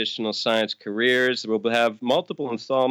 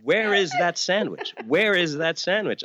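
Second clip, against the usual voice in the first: mock translation; 7 semitones higher than usual. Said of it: "I was saying".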